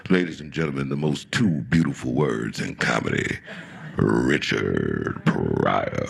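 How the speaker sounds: a hint of a big-room announcer; emcee intro. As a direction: bring on the headliner like the MC. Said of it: "his man goes".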